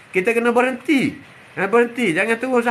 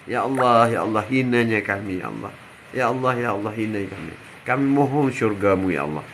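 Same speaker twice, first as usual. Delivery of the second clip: humble prayer; 9 semitones lower than usual. Pretend. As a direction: plead gently, humbled before God. Voices humble prayer to God.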